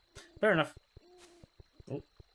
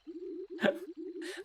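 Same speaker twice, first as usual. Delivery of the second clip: breathy granny cackle; 13 semitones higher, 8 dB quieter than usual. Acted.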